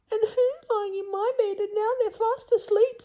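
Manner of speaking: very high, squeaky little bear; tiny baby bear voice, way up high. Acted. Baby Bear pointing out the sleeper, squeaky high. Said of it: "said Baby Bear".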